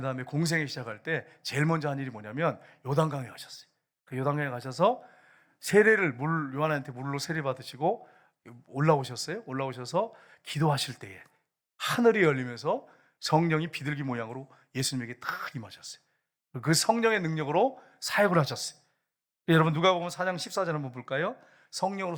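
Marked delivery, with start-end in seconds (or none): none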